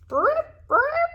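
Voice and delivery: very high squeaky burp sounds; frog burps. Acted. Frog answers the lizard with squeaky high burps. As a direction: croak out two tiny burps. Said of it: "said the frog".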